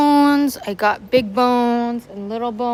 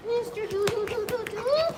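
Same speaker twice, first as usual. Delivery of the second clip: high, sing-song pet voice; 11 semitones higher, 3 dB quieter than usual. Real, not acted. Baby talk to dog.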